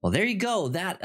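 ordinary talk

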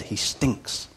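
He stinks, whispered very softly. Real, not acted. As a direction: drop to a whisper on the punch.

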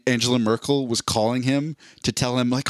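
ordinary delivery

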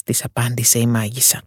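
ordinary delivery, nothing marked

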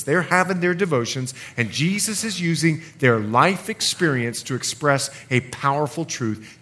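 ordinary talk, nothing marked

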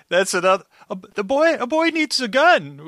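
high, incredulous repeat of the line; mock disbelief. Podcaster mocks the line in a high squeak of disbelief. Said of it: "I was like".

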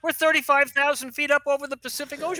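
high-pitched, hammed-up disbelief; incredulous protest. Doctor to captain over the Pacific Ocean, mock incredulous protest. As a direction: balk at an absurd suggestion, pitch way up.

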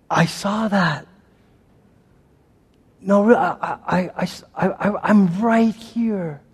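soft, slow, quiet reassurance; calm and tender, just present; God replying softly, slow and quiet; gentle God. Retold or imitated.

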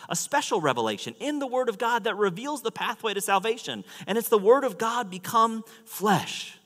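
high, loud, driving sermon voice; impassioned preaching. Real, not acted. Preacher proclaims God's revelation to the congregation, impassioned preaching. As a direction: preach it out with full force.

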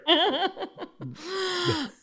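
amused burst of laughter. Real, not acted. Host laughing with guest about flowery shirts, amused laugh. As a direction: laugh along at a shared joke.

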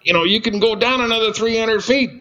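high, breezy mock-casual voice; Dwayne's voice. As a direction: pitch it up and make it sound like no big deal. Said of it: "And he goes".

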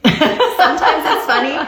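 laughing through the words, slow and high; can't help laughing as she says it. Real, not acted. Mother laughing about her son's rude nickname for her, laughing.

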